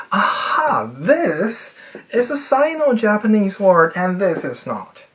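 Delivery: mock brain having a breakthrough; eureka moment. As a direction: exclaim a sudden realization. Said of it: "Your cerebral cortex will be like".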